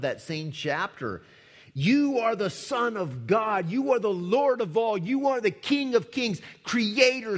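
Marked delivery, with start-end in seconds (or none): 1.7-7.4 loud proclamation. Acted. Demons proclaiming Jesus.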